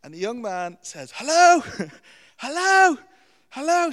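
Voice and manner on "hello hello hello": high, very loud screamed hellos; screaming at bird. Retold or imitated. Homeless teen screams hello at a pet shop parrot, very loud high yell. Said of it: "starts screaming at this bird".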